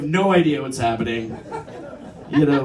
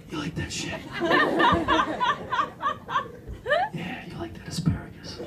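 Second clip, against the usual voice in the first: hushed seductive whisper to diners; waiter dirty talking; 6 dB quieter than usual. Retold or imitated. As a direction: breathe it to the table like dirty talk. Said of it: "why is our waiter dirty talking us?".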